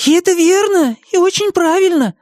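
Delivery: very high, eager character voice; squeaky, heated approval; fervent agreement. A narrator's reading. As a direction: back the dragon up eagerly. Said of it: "горячо поддержал его Мартын".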